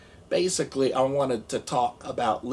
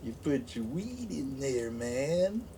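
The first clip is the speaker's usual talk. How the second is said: Jim Breuer impression; 8 dB quieter than usual. Retelling a comedian line, soft dazed stoner voice. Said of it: "how Jim Brewer used to say".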